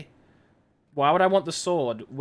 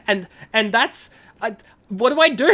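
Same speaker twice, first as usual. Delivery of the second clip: flustered; 5 semitones higher, 7 dB louder than usual. Real, not acted. Flustered by flirting goblin.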